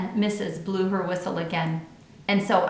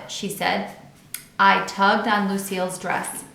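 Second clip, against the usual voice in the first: plain reading voice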